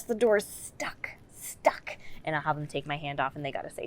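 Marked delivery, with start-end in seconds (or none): 0.0-2.0 slow conspiratorial whisper of "stuck"; hushed "stuck, stuck". Shown as a demonstration. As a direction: whisper it like a secret at the door. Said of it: "often I'll say".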